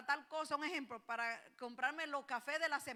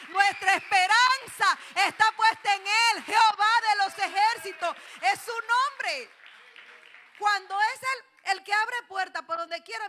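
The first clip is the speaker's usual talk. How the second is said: full-force preaching; 5 semitones higher, 14 dB louder than usual. Acted. Pastor proclaims trust in God to the congregation at full force. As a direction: thunder it from the pulpit.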